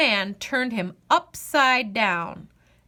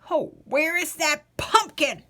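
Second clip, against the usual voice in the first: put-on croaky, lamenting old man; old man croaking in despair; 5 semitones higher than usual. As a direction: moan it like a fretful ancient. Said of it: "croaked the old man".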